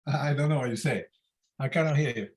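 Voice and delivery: ordinary delivery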